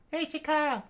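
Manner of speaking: high voice calling; Fuko calling. Acted. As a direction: call Chikara. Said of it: "Fuko said".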